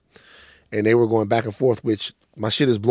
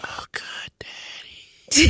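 high breathy mock-seductive whisper; mock sultry whisper; 14 semitones higher, 4 dB quieter, much slower than usual. Host mocks the cohost's ASMR, sultry mock whisper. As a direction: whisper it like a creepy ASMR video. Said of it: "the type of shit you say".

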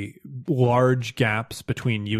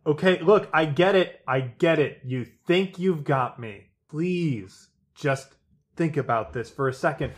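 desperate begging; 4 semitones higher, faster than usual. Acted. Suspect begs, offers credits.

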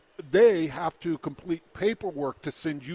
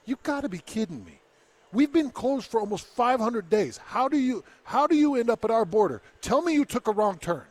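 higher, louder disbelieving officer; incredulous Mountie; 6 semitones higher, 3 dB louder than usual. Retold or imitated. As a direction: play a Mountie who can't believe it. Mountie voiced in story.

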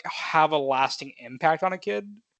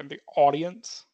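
slow, drawn-out Boston vowel on audience; Boston accent; slower than usual. Retold or imitated. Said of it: "You sound like you're from Boston right there".

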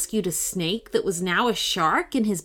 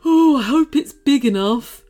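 higher, louder fretful creature; fretting; 6 semitones higher, 7 dB louder than usual. Acted. Anxious snake fretting over the tub, fretful. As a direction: wring your hands in the voice. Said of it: "fretted the snake".

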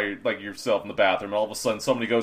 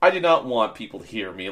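ordinary talk, nothing marked

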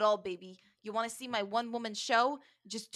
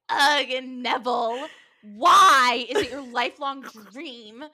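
mock-exasperated teen girl, louder; mock Carly; 2 semitones higher, 9 dB louder than usual. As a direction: whine it at Neville, slow and drawn out. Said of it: "Carly is like".

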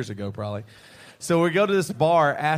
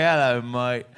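mock Aussie "Hello, mate", lower; a deliberately bad Australian accent; butchered Australian accent; 4 semitones lower, slower than usual. Singer to the podcast hosts, bad Australian accent. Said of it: "I'm gonna butcher your accent".

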